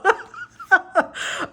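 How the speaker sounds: high, loud burst of laughter. Real, not acted. Reader cracking up after her blunt line, loud laugh. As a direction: let a big laugh out.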